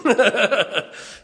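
high triumphant cackle; gloating laugh. Retold or imitated. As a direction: laugh like you got even. Gloats over getting his daughter back.

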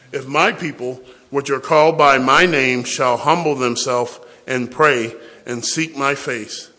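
loud, slow scripture read; loud, slow preaching of the verse; preaching at full force. Real, not acted. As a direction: proclaim it slowly, word by word.

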